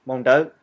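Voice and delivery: loud "Hmong Dao", plain d in "Dao". Shown as a demonstration. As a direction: say "Hmong Dao" firmly, rising on "Dao".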